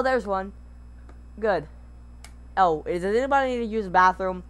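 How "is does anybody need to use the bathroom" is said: usual speaking voice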